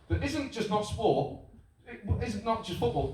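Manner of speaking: ordinary talk, nothing marked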